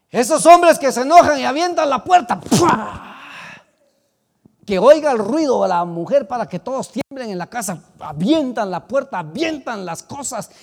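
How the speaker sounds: loud, high fiery rebuke; preaching at full force. Acted. Preacher denouncing door-slamming husbands, loud fiery preaching. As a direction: thunder against the angry men. Rebukes angry men loudly.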